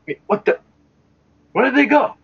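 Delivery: high-pitched, startled double take; baffled surprise. Acted. Passer-by turns back to find nobody there, baffled. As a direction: squeak it out in acted bewilderment.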